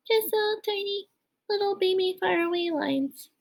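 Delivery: high, soft, tiny little voice; playful baby-small, distant voice; far-away voice. Pretend. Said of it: "This is my far, far away voice".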